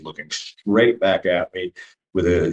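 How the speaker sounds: ordinary delivery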